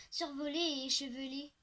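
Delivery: usual speaking voice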